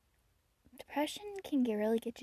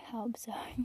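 whispering; 5 dB quieter than usual. Pretend. Whispered repeat.

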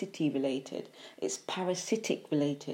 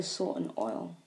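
ordinary talk, nothing marked

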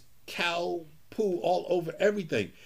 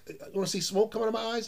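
fast teasing grandpa; 10 semitones higher, faster than usual. Retold or imitated. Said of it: "He says".

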